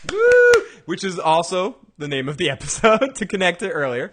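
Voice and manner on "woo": loud, high-pitched "woo"; high whoop. Real, not acted. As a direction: let out a sudden high whoop. Playful whoop after co-host's pronunciation.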